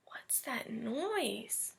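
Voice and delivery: slow, breathless whisper in the dark; whisper, scared to be heard; frightened whisper. Acted. Woken boy asks himself about the noise, frightened whisper.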